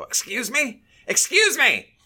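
high, loud mock indignant yell, escalating; indignant shout. Angry older shopper calling after a maskless man, mock indignant yell. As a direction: imitate an outraged man demanding attention.

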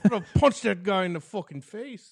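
hammed-up furious Irish rant; offended Irishman. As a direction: rage at the bartender over the clover. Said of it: "He was very offended".

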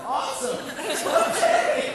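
high, loud fake-cheery yelp; mock excitement. Counselor answering the worried dad with loud fake cheer. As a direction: sell a forced burst of enthusiasm. Mock enthusiasm after scary warning.

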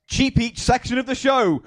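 high showy segment intro; hyped announcement. Pretend. Host introducing final part of the show, high mock fanfare. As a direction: announce it high, ending in a cheer.

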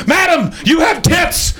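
very high, slow, hammed-up shouted announcement; mock messenger shout. Bike messenger yelling at a woman, very high hammed-up shout. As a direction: bellow it like a helpful town crier.

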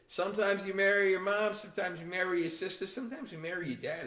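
high-pitched comic declaiming; mock pronouncement. Comic bit after wife impression.

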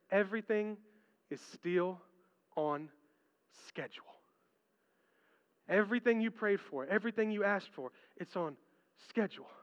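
soft, fading calm voice; gentle reassurance. Pretend. God soothing a worried believer, soft and calm. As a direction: hush them with a calm promise.

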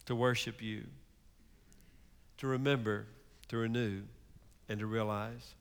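a pastor leading his people in closing prayer, hushed and sincere; earnest prayer. Acted. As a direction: pray over the congregation gently.

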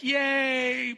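high, loud playful yay; mock cheer. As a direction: cheer along teasingly. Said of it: "I'm like".

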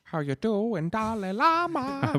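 high drawn-out goofy holler; mock singer greeting. [name] greets the Dalai Lama, goofy high voice. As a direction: draw the last words out high and silly.

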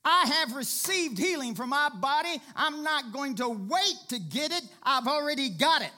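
loud and high, shouting faith; triumphant declaration. Acted. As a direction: cry it out like victory is won. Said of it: "you go".

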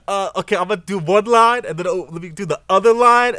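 loud, high mock of a fussy rapper; mocking studio rappers. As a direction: imitate a rapper punching in line by line. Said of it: "those rappers who be like".

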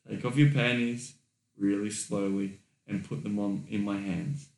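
soft low instruction with long pauses; hushed, deliberate dirty talk; quiet, unhurried command. Shown as a demonstration. Repeats the demonstrated command.

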